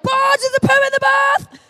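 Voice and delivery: high, loud panicked call across the house; shrieked mock alarm; retold alarmed shout. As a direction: yell the alarm about the bath. Calling out poo in bath.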